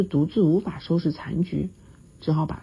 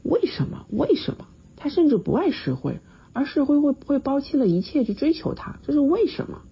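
higher, distraught wife's lament; plead, voice climbing in distress; mildly shaky, high pleading; trembling; 10 semitones higher than usual. A narrator's reading. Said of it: "周太太颤抖地说".

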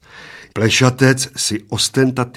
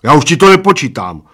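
loud, raised, obstinate announcement; stubborn; 6 semitones higher, 8 dB louder than usual. A narrator's reading. Bald man refuses to keep counting, stubborn. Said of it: "oznámil plešatec umíněně".

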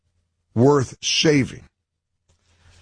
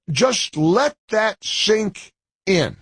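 higher and drawn out, word by word; slow, spaced-out delivery at raised pitch; slow emphatic repeat; 5 semitones higher, slower than usual. Real, not acted. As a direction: stretch it out so every word lands.

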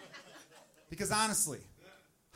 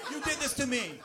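high-pitched accusing movie wail; mock yelling; 9 semitones higher than usual. Movie wife in labor blames husband, mock yelling. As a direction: hurl blame in a high, hammed-up yell. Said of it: "they're yelling at each other".

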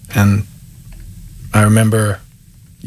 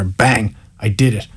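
ordinary talk

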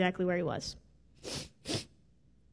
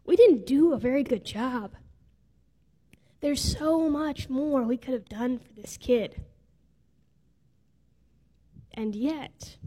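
choked up. Real, not acted. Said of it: "I don't think I'm gonna make it through today".

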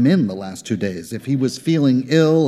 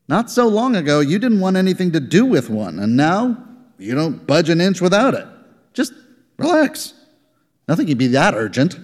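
high, needling coworker voice; teasing coworker; 7 semitones higher than usual. Acted. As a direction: rib him about the phone. Rogler teasing Ebling.

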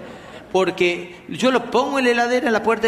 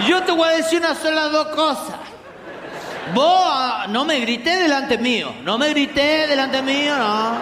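high, slurred mock drunk scolding; drunk rant; 6 semitones higher than usual. Drunk relative at dinner, high mock drunk scolding. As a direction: scold like a tipsy uncle.

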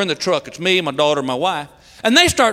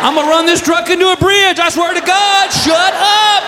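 high-pitched, fast frantic yell; exasperated threat; 5 semitones higher than usual. Acted. Dad yelling at wife and daughter in the truck, frantic. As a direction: threaten wildly, at the end of your rope.

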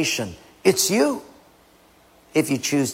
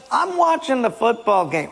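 dismissive husband; 8 semitones higher than usual. Acted. Acted husband brushing off wife.

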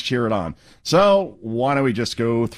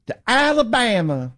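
mock Southern accent; 11 semitones higher than usual. Host parroting the congresswoman in a mock Southern accent. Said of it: "She sounds like Forrest Gump".

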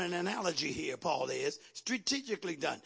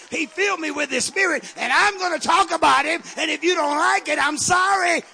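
fervent testimony, louder with every phrase; impassioned preaching; 6 semitones higher, 14 dB louder than usual. Real, not acted. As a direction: build from raised voice to full high shout. Preaches at full force, building.

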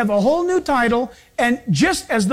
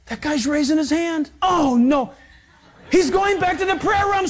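hammed-up panicky God, higher at the end; sermon joke, a fussy God in a fret; a preacher playing a nervous God for laughs; mock fretting God; 5 semitones higher than usual. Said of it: "He doesn't go".

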